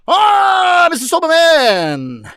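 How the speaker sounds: high cry then slow loud call; excited cry. Acted. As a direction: gush over the stall's name. Customer exclaims over shop name.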